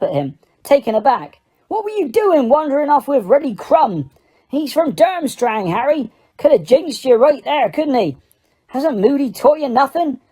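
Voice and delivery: high, heated telling-off; angry scolding. A narrator's reading. Hagrid scolding Harry for wandering off with Krum, angry. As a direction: scold him like a worried uncle. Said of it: "said angrily to Harry".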